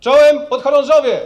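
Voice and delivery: high, loud commander's greeting; parade greeting shout. Real, not acted. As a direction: call out to the whole parade. General greets the cadets on parade.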